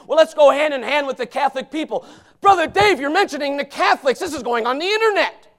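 loud, high, hollering sermon; fiery preaching. Real, not acted. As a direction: preach it at full force to the pews. Preaching at full force.

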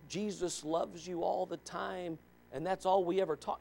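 a hushed, honeyed imitation; mock feel-good preacher. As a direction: parody a soothing TV preacher. Mocking a soft feel-good preacher.

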